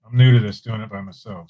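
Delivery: usual speaking voice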